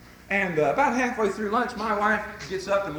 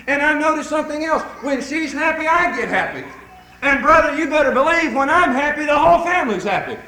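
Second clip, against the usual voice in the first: loud, raised pitch, rolling preacher momentum; full-force preaching; 6 semitones higher, 7 dB louder than usual. Real, not acted. Preacher telling husbands about happy wives, full-force. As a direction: preach it out to the brothers.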